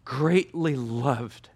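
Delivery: very slow, tender reading; slowly savored scripture; slow reverent quote. Acted. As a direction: stretch each word out with reverence. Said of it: "I repeat it again in Daniel".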